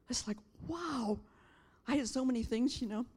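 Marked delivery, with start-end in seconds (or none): 0.1-1.2 a breathless whispered wow; awed whisper. Real, not acted. Pastor stunned by God's word, awed whisper. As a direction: hush it in awe.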